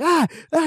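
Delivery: high imitated cries; two squealed scuffle cries; mock drunken yelps. Drunk brother being smacked with a phone, high mock yelps.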